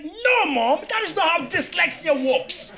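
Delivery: high, loud exasperated protest. Retold or imitated. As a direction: cry out at mom in disbelief. Arguing with mom.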